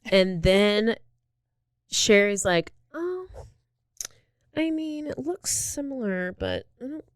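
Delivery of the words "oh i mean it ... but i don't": high, soft, hesitant mock innocence; tentative little voice with long hesitations; imitated meek denial. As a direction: play a suspect feebly hedging, small and high-voiced. Acting suspect meekly denying the photos.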